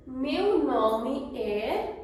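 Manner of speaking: Meu nome é drawn out slowly, rising on Meu; slow model answer. Shown as a demonstration. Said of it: "The answer is".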